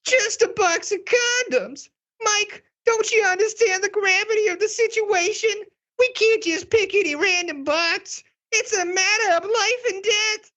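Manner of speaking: very high, tearful drama queen wail. Acted. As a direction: wail it like a stage diva close to tears. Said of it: "this is my drama queen voice".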